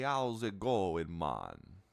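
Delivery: hammed-up Jamaican troll, stretched out slowly; Jamaican accent. Gamer imitating trolls that all talk Jamaican, hammed-up Jamaican accent. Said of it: "They're all Jamaican".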